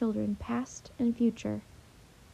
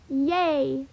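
bright cry of joy, a little louder; cheering; 3 semitones higher, 5 dB louder than usual. Acted. Annie cheers when Washington agrees to march on. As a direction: let out a happy cheer. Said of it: "cried Annie".

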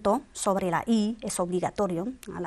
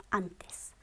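whispered answer; 2 semitones lower, 10 dB quieter than usual. Shown as a demonstration. Teacher whispers the answer to the student, whispering.

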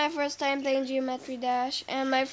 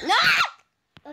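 high, loud outburst of 'No!'; real shout; 12 semitones higher, 8 dB louder than usual. Real, not acted. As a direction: shout it suddenly, pitched way up. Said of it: "You just shout".

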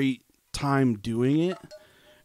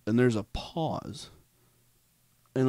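ordinary delivery

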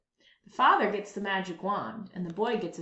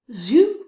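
louder rising zoop; 2 semitones higher, 11 dB louder than usual. A narrator's reading. Narrator sounds the wand taking a saw. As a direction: zap the saw away.

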